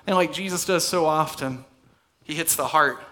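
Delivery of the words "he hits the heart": ordinary talk, nothing marked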